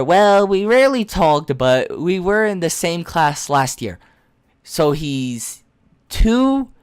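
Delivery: ordinary talk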